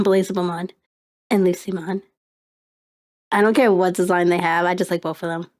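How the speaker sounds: usual speaking voice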